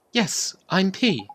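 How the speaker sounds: high, slow little fairy; lightly voiced gentle sprite. Fairy answering the boy, high and slow. Fairy character introduces herself.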